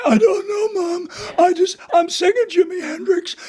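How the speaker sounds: put-on high, crying teenage protest; teen son answering mom, high and whiny. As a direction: whine back at mom like a hoarse kid. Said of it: "Mom would say".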